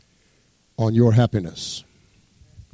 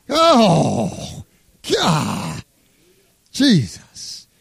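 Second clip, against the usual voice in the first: a crying-out "Oh, God" set higher; overcome cry; 3 semitones higher, much slower than usual. Real, not acted. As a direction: break out in a cry of feeling. Pastor overcome mid-sermon.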